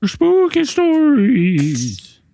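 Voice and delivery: high, very slow spooky voice; skeleton voice. Acted. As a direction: stretch it like a ghost. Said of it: "you and these filters".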